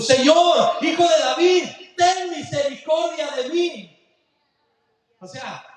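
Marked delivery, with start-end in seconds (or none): 0.0-4.0 higher, louder pleading cry; humble cry. Acted. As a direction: beg for mercy out loud. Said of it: "te fijas ahora la actitud". (clamor humilde)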